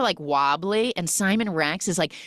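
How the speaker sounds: ordinary talk, nothing marked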